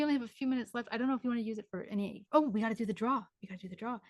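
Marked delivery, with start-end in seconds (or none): 3.4-4.0 much softer, half to herself; quiet repeat to self. Real, not acted. Medium repeats the raffle reminder softly to herself. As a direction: murmur it again as a note to self.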